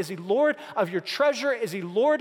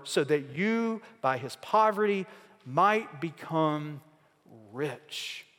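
slow, low, softening reading of the verse; solemn scripture reading; 6 semitones lower, 4 dB quieter, slower than usual. Acted. Pastor reading Scripture to the congregation, slow and hushed. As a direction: let each phrase of the verse sink in, slowly. Reads verse nine aloud.

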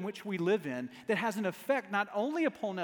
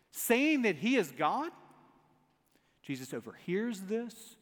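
ordinary talk

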